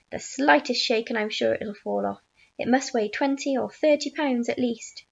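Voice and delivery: plain reading voice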